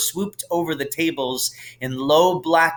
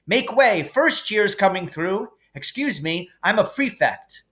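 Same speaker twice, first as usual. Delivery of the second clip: higher, bossy, self-important orders; pompous prefect; 8 semitones higher than usual. A narrator's reading. Percy marching the first years off, pompous and bossy. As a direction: lead the first years, full of himself.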